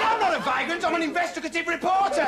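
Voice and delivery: high-pitched, worked-up denial; indignant protest. Acted. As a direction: defend your job in outraged high voice. Indignant reply to park keeper.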